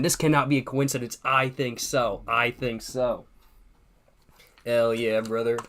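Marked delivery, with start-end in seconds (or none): none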